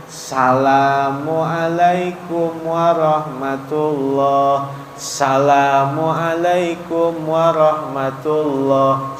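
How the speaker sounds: slow chant of "Assalamualaikum" begun at sa; drawn-out "Assalamualaikum", opening as- left off; salam without "as". Shown as a demonstration. Ustadz showing what voids prayer, chanted "Assalamualaikum" missing as-. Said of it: "Tapi bacanya".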